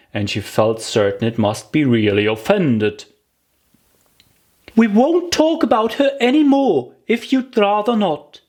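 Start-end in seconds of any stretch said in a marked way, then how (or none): 4.8-8.4 put-on higher girlish voice, fond and chatty; Alice coaxing. Alice telling the mouse about her cat, higher and fond. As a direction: sweet-talk the mouse about Dinah.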